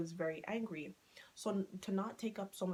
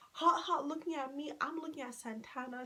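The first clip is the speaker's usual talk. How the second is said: ordinary talk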